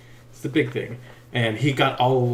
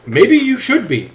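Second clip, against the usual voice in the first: higher, louder and slower, cutting; sassy comeback; 8 semitones higher, 7 dB louder, slower than usual. Retold or imitated. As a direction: throw it back at her. Snapping back at vague coworker.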